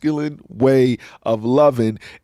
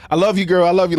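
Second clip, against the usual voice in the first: high, loud gushing friend voice; gushing girlfriend; 8 semitones higher, 6 dB louder, faster than usual. Retold or imitated. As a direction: mimic women cheering each other on, higher and louder. Imitating women greeting friends.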